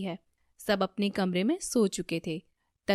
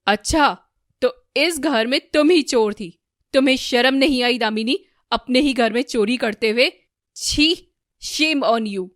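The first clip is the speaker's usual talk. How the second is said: very loud, angry scolding; furious; 4 semitones higher, 10 dB louder than usual. A narrator's reading. Husband Ramesh shames his thieving wife, furious. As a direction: berate with loud disgust. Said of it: "Ramesh बहुत गुस्सा होता".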